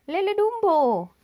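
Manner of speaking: loud, slow excited cry; shouted exclamation. A narrator's reading. Pak Badru shouts at the shop sign in excitement. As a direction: call out the sign's words in excitement. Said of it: "teriak Pak Badru".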